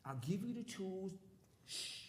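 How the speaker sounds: soft, secretive, half-whispered coaxing; hushed exploiter's voice. Retold or imitated. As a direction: lean in and hush them like a shady boss. Voicing an exploiter hushing someone.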